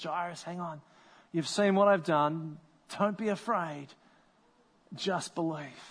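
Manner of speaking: steady a grieving father; hushed, tender encouragement; gentle reassurance. Acted. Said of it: "he says to Jairus".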